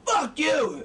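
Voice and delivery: hammed-up furious retort; angry. Shown as a demonstration. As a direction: demonstrate anger, overacted. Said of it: "if you're angry".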